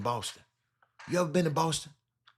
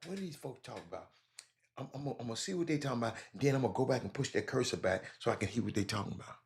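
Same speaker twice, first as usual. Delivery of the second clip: fast, soft rambling aside in a put-on voice; a newcomer lost in the chat, mumbling fast to himself; mock clueless newcomer; 3 semitones lower, 8 dB quieter, much faster than usual. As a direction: rattle it off under your breath like a lost newcomer. Imitating clueless new chat viewers.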